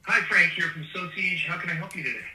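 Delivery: ordinary delivery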